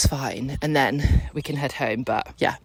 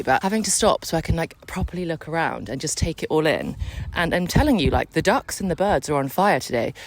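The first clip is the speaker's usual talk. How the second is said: ordinary delivery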